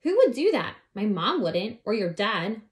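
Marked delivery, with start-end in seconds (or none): 0.0-0.8 high, loud puzzled question; puzzled outcry. Acted. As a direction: cry out in disbelief. Abby wonders who did it.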